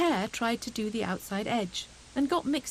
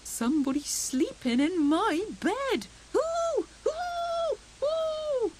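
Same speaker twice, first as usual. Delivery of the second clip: high, squeaky alarm; frightened squirrel; slower than usual. A narrator's reading. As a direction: squeal at the intruder. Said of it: "squeaked Squirrel".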